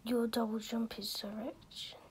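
soft unvoiced mutter to herself; whispered aside. Real, not acted. Gamer mutters confused to herself, whispering. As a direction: whisper it under your breath.

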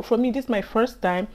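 usual speaking voice

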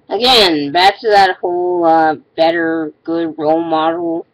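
ordinary talk, nothing marked

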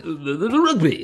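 high-pitched, stammered blurt; embarrassed blurting. Retold or imitated. Flustered fan blurting out rugby talk to hide embarrassment, embarrassed squeal. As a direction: leap up high and loud on a flustered non sequitur. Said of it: "My go-to whenever I'm embarrassed".

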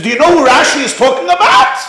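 high, loud, fast and laughing; mock him with a laugh; taunting. Rebbe taunting the boy before the class, loud.

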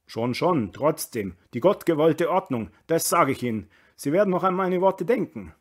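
loud, insistent, rising to a high warning; ranting reactionary burgher. A narrator's reading. Dierriegel warns Kammerlander about the order, indignant. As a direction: insist stubbornly like a doomsayer.

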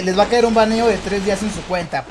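ordinary talk

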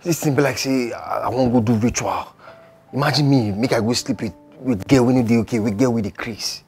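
loud, insistent venting; worked-up, emphatic complaint to a friend; agitated protest. Acted.

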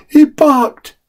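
put-on a little boy glad his dog lives; relieved small boy. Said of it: "said the small boy".

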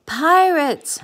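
high, loud lookout's cry; alarmed storybook cry; cried out. A narrator's reading. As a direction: shout the warning from the crow's nest. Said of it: "cried the elephant from the lookout perch".

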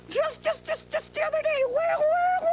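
impatient prodding. Acted. Dummy prodding partner.

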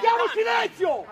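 high, loud command; shouted order. Real, not acted. Player orders teammates to stop talking, shouting. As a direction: shout down the arguing.

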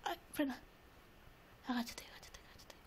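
hushed repeated urging, breath only; urgent whisper. Real, not acted. Urging her character to crouch, urgent whisper. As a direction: whisper it fast and tense.

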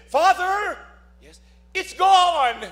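put-on high, slow, light boy's voice; relieved young man. Freed young man telling the priest, high slow boy's voice.

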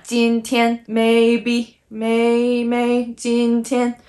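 今天 and MAYBE held on one high level pitch; each syllable drawn out flat and high; both even. Shown as a demonstration. Teacher matching 今天 to English, both even. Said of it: "Both are even".

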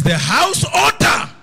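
loud, high and slow preaching shout; shouted proclamation. Retold or imitated. Preacher shouting God's word to the congregation. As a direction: proclaim it to the whole church. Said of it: "He says".

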